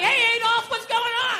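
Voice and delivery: high-pitched mock female voice; lightly hammed-up hello; woman's greeting. Acts woman greeting Adolf flirtatiously.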